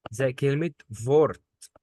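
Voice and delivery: فورت with W said as v, slowly; drawn-out فورت starting with v; slow modelled word. Shown as a demonstration. Teacher slowly modelling W as v. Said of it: "الدبليو ده بنطقه في".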